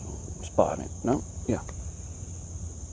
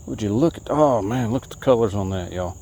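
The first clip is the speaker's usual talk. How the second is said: normal talking voice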